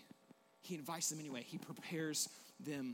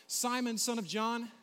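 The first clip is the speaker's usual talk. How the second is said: calling by name; 6 semitones higher, 6 dB louder than usual. Acted. Jesus calls Peter by name.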